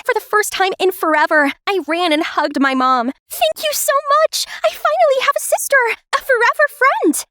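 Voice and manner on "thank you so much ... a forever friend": much higher, bubbling with joy; overjoyed. Acted. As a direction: gush with delight over a new sister. Meets new adopted sister, overjoyed.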